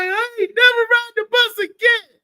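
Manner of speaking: put-on high, loud voice of a sulking three-year-old; upset little boy. Son tells great-grandmother, high and loud: never riding the bus again. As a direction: wail it like a stubborn toddler.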